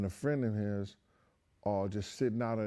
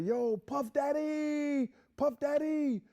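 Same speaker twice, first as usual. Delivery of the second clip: much higher, slow, drawn-out excited shout of a name; high, slow, stretched fanboy yell; starstruck fan call; slower than usual. Retold or imitated. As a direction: call out like a thrilled young fan spotting a celebrity. Excited kid greeting [name].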